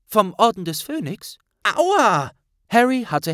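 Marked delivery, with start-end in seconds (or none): 0.1-1.4 sharp, higher surprised question. Acted. Hermine überrascht, laut. 1.6-2.3 high, loud pained yelp. Acted. Tritt gegen das Schienbein.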